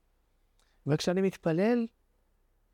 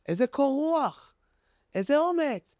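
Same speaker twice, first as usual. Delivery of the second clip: higher-pitched, marvelling exclamation; amazed admiration; 7 semitones higher than usual. Acted. Passenger to the calm little girl after the air pocket, amazed. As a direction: marvel at it, pitch lifted, words stretched.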